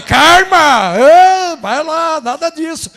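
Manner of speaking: high, loud call, fading down; excited shout. Retold or imitated. Preacher retelling his reply to the crying man, loud high shout.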